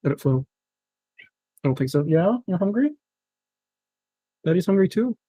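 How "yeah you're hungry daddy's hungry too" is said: higher, sing-song voice for the pet; a higher "Daddy" voice for the pet; pet talk. Real, not acted. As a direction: coo to the hungry pet. Talks to his pet.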